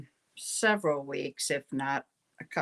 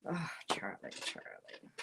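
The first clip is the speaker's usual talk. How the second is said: soft unvoiced whisper to the dog; 9 semitones lower, 11 dB quieter than usual. Real, not acted. Speaker hushing her dog Charlie during the reading, whispered. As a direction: hush the dog under your breath.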